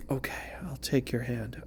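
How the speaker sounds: hushed breathy whisper. Pretend. The querent accepting the hand, whispered. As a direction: whisper it like a secret surrender. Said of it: "You're like".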